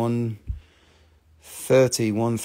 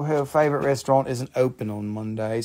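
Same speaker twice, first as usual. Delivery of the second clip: normal talking voice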